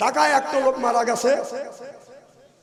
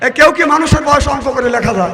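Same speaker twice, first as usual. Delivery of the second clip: raised, insistent rhetorical question; loud challenge; 4 semitones higher, 10 dB louder than usual. Real, not acted. Preacher re-asking a silent crowd, loud challenge. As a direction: put it to the crowd loudly. Repeats question louder after no answer.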